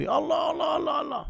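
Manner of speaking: high, yelped mock panic; imitating a panicked man in an earthquake; mock panic cry. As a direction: cry out in mock fright during the quake. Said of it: "baru teriak".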